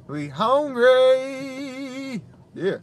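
very long, high, loud drawn-out cry; playful holler. Pretend. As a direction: holler it out and stretch the last word. Playful holler about being hungry.

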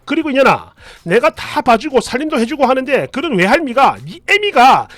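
acted angry mother-in-law berating her daughter; furious scolding. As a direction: scold hard, voice climbing as it builds. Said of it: "이렇게 버럭버럭 하시더니".

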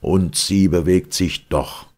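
loud, slow defiant outburst; Galileo's stubborn shout of defiance; defiant cry. A narrator's reading. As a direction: stamp and cry out the truth. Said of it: "die Erde stampfend ausrief".

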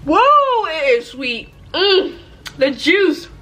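talking between bites; chewing while talking. Real, not acted. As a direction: mouth partly full. Seen 2: Bites sweet at -5, chews with puckered lips until about 2.7s.

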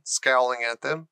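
ordinary delivery, nothing marked

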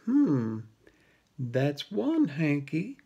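lightly whiny cat, soft and sly; a hint of a smug whiny cat. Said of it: "a familiar whiny voice".

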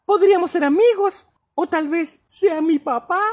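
high little kitten voice; a tiny hopeful kitten. A narrator's reading. Kitten speaks in story.

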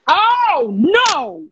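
loud high dismayed cry; stunned outburst; shocked oh no. Real, not acted. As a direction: react in loud disbelief. Reacting to bad sound.

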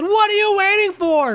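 squeaky high voice, drawn out; high-pitched mock taunt. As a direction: shriek it high and slow. Mocking victim's taunt.

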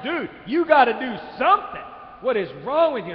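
high, loud shouting from the shore; retold angry yelling. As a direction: yell at him as an outraged onlooker. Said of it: "are yelling at him".

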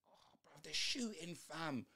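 hushed whisper to himself; a soft, voiceless aside while watching. Real, not acted. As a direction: breathe the words out with no voice. Commenting quietly during the game.

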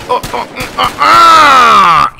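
high grunts building to a loud yell; staccato cries, then one long high holler; battle yell. Acted. Player fires snub-nose at enemy, building battle yell. Firing his revolver in game.

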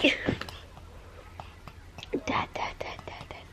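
soft unvoiced whisper. Real, not acted. Child whispers for his dad, a soft whisper. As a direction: breathe the name in a whisper.

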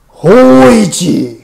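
harsh, rough shout of the name. A narrator's reading. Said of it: "三度目の声が荒々しく".

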